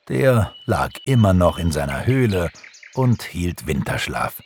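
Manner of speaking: ordinary delivery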